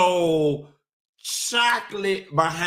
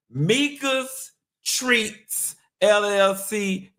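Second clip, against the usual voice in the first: ad read; 3 semitones higher, slower than usual. Acted. Slow ad plug.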